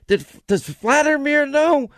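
high, slow eager questioner; Adam Schiff impression. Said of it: "That's Adam Schiff".

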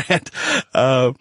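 ordinary talk

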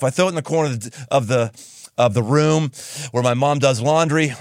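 usual speaking voice

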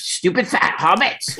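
a light Gollum impression, hissing the insult. Actor retelling Gollum's insult, lightly imitating the sneer. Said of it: "when Gollum says".

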